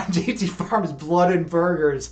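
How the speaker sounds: normal talking voice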